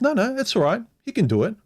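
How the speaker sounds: high-pitched mock of her brushing it off; imitating his date. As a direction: lift the voice high and wave it away breezily. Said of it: "She said".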